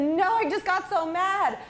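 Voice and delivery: high-pitched, worked-up kid imitation; hammed-up frustrated child, dragging out the complaint; exasperated boy. Boy complains to the driver about the stress ball, exasperated imitation. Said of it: "And he said".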